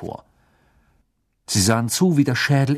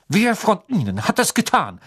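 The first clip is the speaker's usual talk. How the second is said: barked question; 7 semitones higher than usual. A narrator's reading. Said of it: "bellte er".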